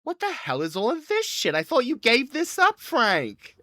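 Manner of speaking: high-pitched scolding wife; high, nagging wife voice; angry wife. Acted. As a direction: screech it at your husband in disbelief. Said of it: "his wife comes in and goes".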